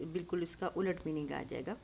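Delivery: usual speaking voice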